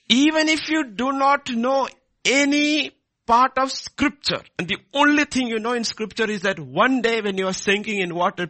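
high, loud, urgent sermon voice; full-force preaching. Acted. Preacher urging listeners to pray "Lord, save me", impassioned. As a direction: proclaim it loudly to the whole congregation.

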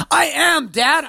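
high, drawn-out protest to dad; ten-year-old daughter. Retold or imitated. Ten-year-old daughter answering dad, mock exasperated and high. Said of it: "She'll look at me. She's like".

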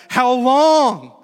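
loud, high anguished cry; crying out to God. Acted. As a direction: shout it out as a drawn-out plea. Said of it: "cry out to God".

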